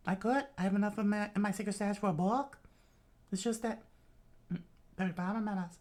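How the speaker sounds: high, put-on Southern foster mother; Southern accent. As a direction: explain in a Southern falsetto. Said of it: "pull up my southern".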